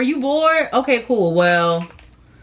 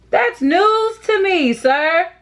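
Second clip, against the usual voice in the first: higher, slower, mock outrage; mock shocked sarcasm; 7 semitones higher, much slower than usual. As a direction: drag it out, exaggerated disbelief. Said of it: "repeated with more bite".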